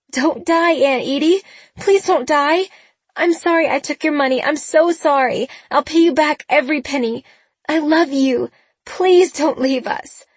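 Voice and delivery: high, loud desperate begging; begging through tears, voice breaking; tearful pleading. A narrator's reading. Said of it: "She started sobbing".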